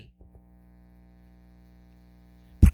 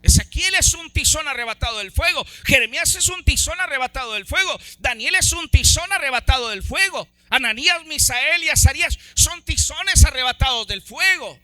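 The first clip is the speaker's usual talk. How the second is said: louder, driving rhythmic preaching; 4 semitones higher, 5 dB louder than usual. Acted. Preacher listing the prophets to the church, driving full-force preaching. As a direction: hammer each name like a drumbeat.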